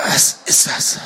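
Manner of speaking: faint, strained whisper; labored whisper. A narrator's reading. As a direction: force out a toneless whisper. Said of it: "brachte Khazad mühsam hervor".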